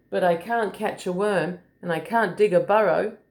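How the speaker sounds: ordinary delivery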